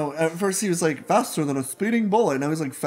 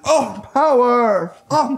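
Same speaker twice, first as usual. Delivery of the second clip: high, loud, slow groaning cry; hammed-up hero losing his powers; weakened Superman; 8 semitones higher, 8 dB louder, much slower than usual. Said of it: "he's like".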